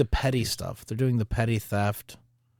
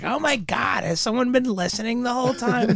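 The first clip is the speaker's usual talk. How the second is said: much higher mock-shocked woman; shocked caller; 12 semitones higher than usual. Retelling the caller who realizes someone was listening, high mock shock. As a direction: squeal it like the caught-out caller. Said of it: "the person on the phone was like".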